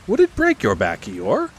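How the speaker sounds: surprised question, starting high; surprised Pooh. Acted. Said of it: "very much surprised".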